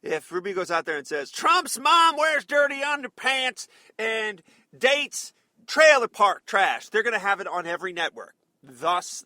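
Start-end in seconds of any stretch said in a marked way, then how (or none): 1.3-6.9 loud, high, drawn-out jeer; mock shouted insults. Host imitating a candidate insulting rival for airtime, loud mock taunt. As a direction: hurl it like a slow, blaring campaign taunt.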